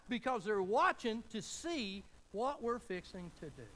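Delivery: slow, loud, drawn-out preacher's punch; loud preaching. Acted. Pastor telling the congregation that outsiders are watching, loud and slow. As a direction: lean hard on every word. Emphatic sermon point then repeated.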